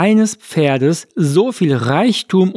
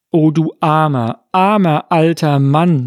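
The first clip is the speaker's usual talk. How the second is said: plain reading voice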